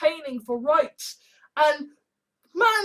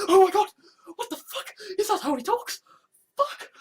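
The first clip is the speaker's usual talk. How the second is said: imitating his own shocked outburst, higher; shocked reaction; 6 semitones higher than usual. Hearing a strange voice, mock shocked outburst. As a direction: gasp it out in alarm. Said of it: "and then just like".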